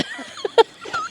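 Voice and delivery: very high-pitched laughter; real laugh. Real, not acted. Teasing companion about exaggerating, high real laugh. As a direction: let a high laugh burst out.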